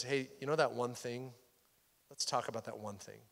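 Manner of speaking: soft and low, almost whispered, as God speaking into the heart; hushed tender voice of God; gentle hushed whisper. Retold or imitated. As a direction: coax it quietly, like a secret shared. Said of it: "he whispers into your spirit".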